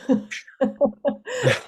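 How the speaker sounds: short, high amused laugh. Real, not acted. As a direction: laugh at how bizarre it is. Amused at geologists' odd wording.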